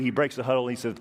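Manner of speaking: ordinary talk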